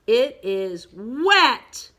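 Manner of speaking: slow, loud build on WET; loud exclamation. Shown as a demonstration. Teacher leads pupils reading the exclamation, slowly and loudly. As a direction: draw it out and punch WET. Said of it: "exclamation point makes it louder".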